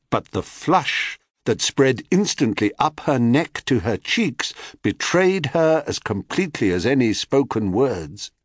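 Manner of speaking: plain reading voice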